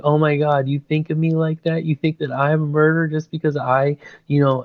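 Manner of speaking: high, put-on weepy voice, hammed up; imitating a fake teary sob story; mock crying plea. Villain fakes tears to a frightened classmate, mock weepy. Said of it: "Mitsuko just starts crying".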